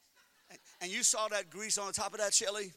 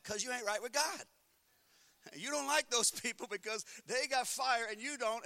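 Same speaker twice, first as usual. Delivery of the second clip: normal talking voice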